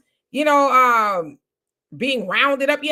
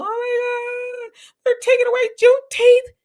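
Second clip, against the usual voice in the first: mock panic; 13 semitones higher, 6 dB louder than usual. Mocking the uncomfortable crowd, a hammed-up much higher wail. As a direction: ham up a clutching-pearls gasp. Mocking people upset over Juneteenth.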